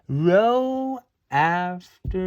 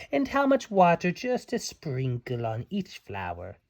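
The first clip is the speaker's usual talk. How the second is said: ordinary delivery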